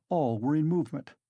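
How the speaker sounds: usual speaking voice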